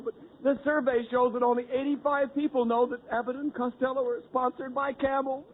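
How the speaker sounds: ordinary delivery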